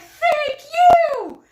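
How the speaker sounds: very high, very loud squeal of thanks; volunteer squealing thanks at a passing runner. Retold or imitated. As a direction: squeal it back at him, thrilled. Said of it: "And I'm like".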